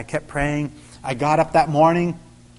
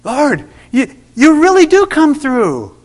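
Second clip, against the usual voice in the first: much higher, louder, slow wonder; overjoyed praise; 10 semitones higher, 7 dB louder, slower than usual. Retold or imitated. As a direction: marvel aloud in gratitude. Thanking God for the check.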